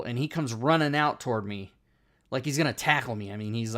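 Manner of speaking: ordinary talk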